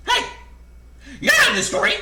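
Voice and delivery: very high, loud hen screech; indignant squawking chicken. A narrator's reading. Chicken Licken objecting to the narrator intruding, high loud squawk. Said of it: "said Chicken Licken".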